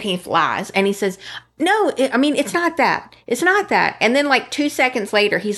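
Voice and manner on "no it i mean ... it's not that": high, mock-defensive protest; put-on excuse-making voice; imitated denial. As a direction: deny it, pitched well up. Voicing his lying denial.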